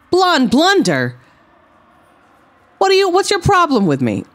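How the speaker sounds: high, fast hammed-up challenge; wrestler trash talk. Wrestler confronting his rival, high hammed-up challenge. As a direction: get in the rival's face.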